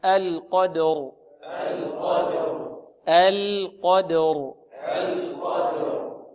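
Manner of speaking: القدر very slow, heavy qaf and ra; repeat after me. Shown as a demonstration. Teacher has students repeat القدر slowly. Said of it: "انطقوا معي".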